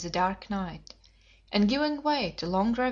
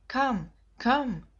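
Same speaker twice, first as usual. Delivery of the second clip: plaintive voice; 2 semitones higher than usual. A narrator's reading. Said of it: "a deep plaintive voice sent up a cry".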